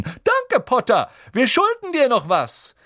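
high, loud gleeful hooting; shrieked mocking cheer; jeering yell. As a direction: holler it like a taunting crowd. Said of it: "Sie pfiffen und johlten".

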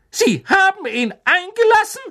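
high, loud horrified shriek. A narrator's reading. McGonagall shrieking at the portrait, very high and loud. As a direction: shriek in disbelief. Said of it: "kreischte Professor McGonagall".

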